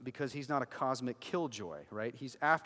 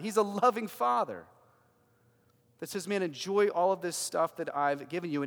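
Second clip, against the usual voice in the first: full-force preaching; 4 semitones higher, 9 dB louder than usual. Real, not acted.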